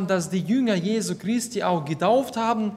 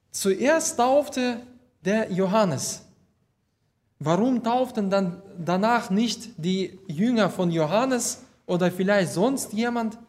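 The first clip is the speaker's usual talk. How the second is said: ordinary talk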